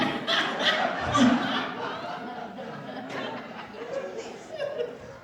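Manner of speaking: silent wheezing laugh at his own joke; breathless laugh. Real, not acted. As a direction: laugh without voice, shaking.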